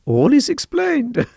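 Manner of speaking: high, slow, knowing voice; amused high-pitched pronouncement; mock revelation. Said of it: "she says".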